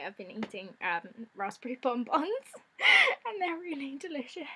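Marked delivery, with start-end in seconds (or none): 2.3-3.2 sudden high squeal of a laugh; high burst of laughter. Real, not acted. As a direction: giggle it out high and loud. Laughing at her blue tooth.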